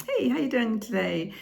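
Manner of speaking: high friendly pet talk; chatting to a cat. Shown as a demonstration. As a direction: greet the cat brightly. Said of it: "just chat with them".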